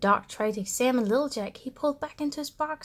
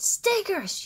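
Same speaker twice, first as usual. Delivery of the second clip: happy yell; 8 semitones higher than usual. Acted. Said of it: "yelled Little Jack".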